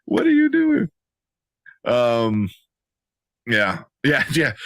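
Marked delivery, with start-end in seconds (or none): 0.0-0.9 high-pitched incredulous questioning; mock-appalled, pitched way up; baffled disbelief. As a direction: squeak out your exasperated bafflement. Acted baffled reaction to character.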